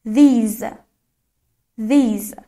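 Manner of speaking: "These" with a long, stretched ee; drawn-out these. Shown as a demonstration. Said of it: "یه کشیده".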